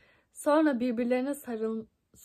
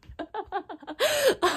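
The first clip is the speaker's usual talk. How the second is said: loud laugh; 8 semitones higher, 6 dB louder than usual. Real, not acted. Reading a silly fanfic to viewers, loud burst of laughter.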